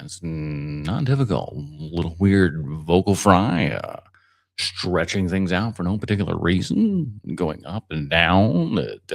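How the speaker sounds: low, slow TV interviewer with odd stretched words; creaky vocal fry, drawing words out and swinging up and down; Chris Hansen impression. Shown as a demonstration. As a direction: stress odd words in a low, deliberate delivery. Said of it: "It's not that hard to do his impression".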